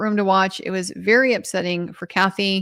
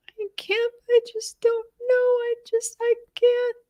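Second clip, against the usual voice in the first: much higher, small and broken imitation of an upset wife; Kathy's high-pitched voice. Narrator imitates the frozen, upset wife in a much higher, thin voice. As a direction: squeeze the words out high and thin, barely getting them out. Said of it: "her voice was, um, just as high-pitched".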